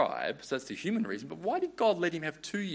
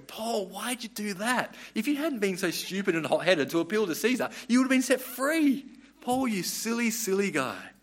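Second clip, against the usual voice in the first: high, mock-scolding voice calling Paul silly; exasperated scolding; 7 semitones higher, 3 dB louder than usual. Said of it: "reading chapter twenty-six going".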